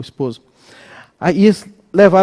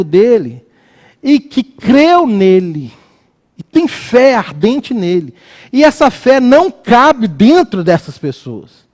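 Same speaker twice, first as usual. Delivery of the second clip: slow, raised preaching voice building in insistence; impassioned preaching; 4 semitones higher than usual. Real, not acted. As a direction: preach it with drawn-out emphasis. Preaches on faith in action.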